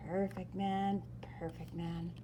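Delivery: usual speaking voice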